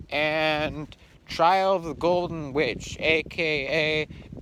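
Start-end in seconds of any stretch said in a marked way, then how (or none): none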